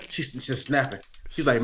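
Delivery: ordinary talk, nothing marked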